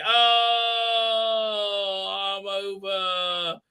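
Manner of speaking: long, high dying wail; hammed-up death cry, drawn out slowly; mock dying. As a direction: groan out a melodramatic last line. Acts out character dying from knife.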